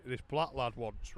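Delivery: ordinary talk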